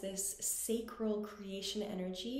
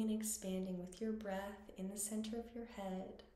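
guided meditation; 5 dB quieter than usual. Acted. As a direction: guide them gently through each breath, unhurried and quiet.

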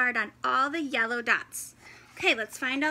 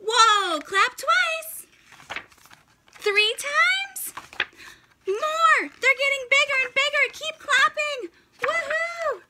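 much higher and louder, rising thrill; building excitement; 9 semitones higher, 3 dB louder than usual. Pretend. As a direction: cheer the kids on as dots grow. Dots grow with clapping.

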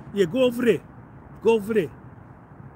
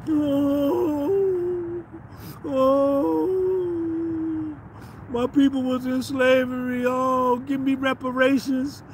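high, drawn-out mocking wail; mock whining beggar; 3 semitones higher, slower than usual. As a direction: whine and beg like a pitiful crybaby in mockery. Mocking reparations whiner.

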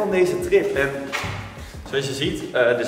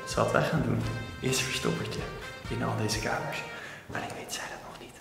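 hushed secret whisper, fast; whispering while hiding; 5 semitones lower, 12 dB quieter than usual. Real, not acted. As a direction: whisper so she won't hear. Secretly planning hide and seek. (fluisterend, stiekem)

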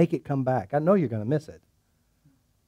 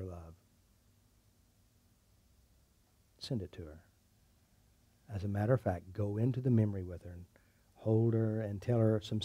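soft, low, soothing hypnotist voice; guided meditation; 6 semitones lower, 10 dB quieter than usual. Acted. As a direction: ease her gently into calm.

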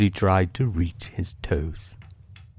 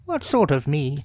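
high, questioning rabbit voice; 7 semitones higher than usual. Acted. Rabbit checking who is outside, high questioning voice. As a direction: query it as a higher-voiced rabbit. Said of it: "said Rabbit".

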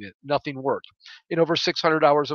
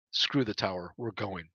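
quiet resolve; 5 semitones lower, 7 dB quieter than usual. Acted. Inner resolve.

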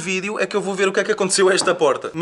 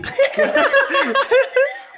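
a burst of high, squealing laughter; high nervous laugh. Real, not acted. Laughing at the laggy camera, high giggle. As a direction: break into a shrill-pitched giggle at the glitch.

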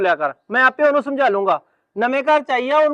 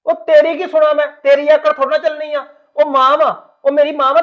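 louder, angry telling-off of his wife; angry scolding; 2 semitones higher, 5 dB louder than usual. Acted. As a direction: put her in her place, hard and loud. Husband scolds sulking wife.

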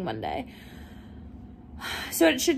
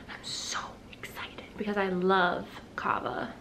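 whispering; 3 semitones lower, 7 dB quieter than usual. Real, not acted. Whispered aside about kava.

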